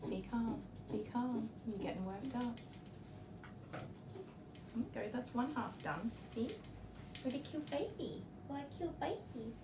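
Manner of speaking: very soft murmur to calm a dog; soothing. Real, not acted. Groomer calming her squirming dog, very soft murmur. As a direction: hush the dog gently, almost under the breath.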